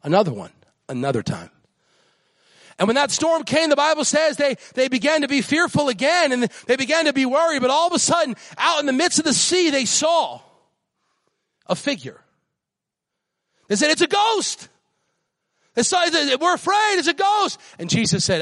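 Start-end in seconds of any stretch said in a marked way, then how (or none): 2.8-10.4 high, ringing preacher's cry; preaching at full force. Real, not acted. 13.9-17.6 high, ringing preacher's cry; preaching at full force. Real, not acted.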